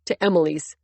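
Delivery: plain reading voice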